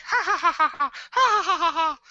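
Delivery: high, loud mechanical ha-ha-ha; very high-pitched imitated doll laughter. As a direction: imitate a toy doll's evenly repeated cackle. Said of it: "it goes back and forth and goes like".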